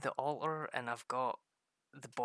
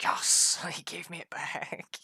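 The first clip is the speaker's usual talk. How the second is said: mock gleeful wife; 5 semitones higher, 9 dB louder, slower than usual. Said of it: "she's like".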